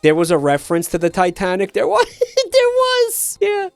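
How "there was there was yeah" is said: laughing high through the words; giggling it out. Real, not acted. Laughing about the reference.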